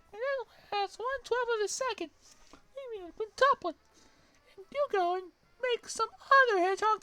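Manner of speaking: put-on very high, loud squeaky girl shriek; Amy voice. Amy screeching at Sonic as she swings her hammer. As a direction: screech it like a furious cartoon girl. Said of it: "Amy berating Sonic".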